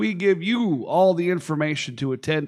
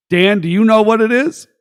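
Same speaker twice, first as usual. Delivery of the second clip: loud challenge; 5 semitones higher, 8 dB louder than usual. Real, not acted. Challenging cohost loudly.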